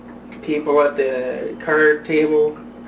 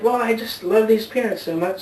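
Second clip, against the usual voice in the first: put-on old woman voice; 7 semitones higher than usual.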